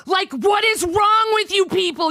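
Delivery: real exasperated yell at critics; angry shout. Real, not acted. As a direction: yell it out, fed up and furious. Ranting at detractors on stream.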